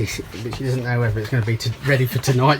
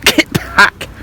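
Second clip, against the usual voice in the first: louder, higher order to back off; firm dog command; 7 semitones higher, 6 dB louder than usual. Real, not acted. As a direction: push the dog away with your voice.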